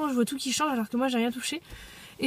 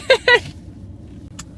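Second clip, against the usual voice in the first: high laugh; 11 semitones higher, 9 dB louder than usual. Real, not acted. After her rant.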